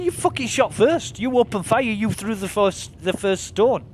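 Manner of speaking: high, loud indignant retort; retold anger. Arguing with the player who shot first, heated and indignant. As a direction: throw the blame back at him, outraged.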